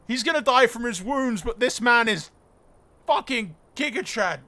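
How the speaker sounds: loud, high, still buzzing from the win; coming down from a real shout; elated. Real, not acted. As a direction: gush at full volume in amazed admiration.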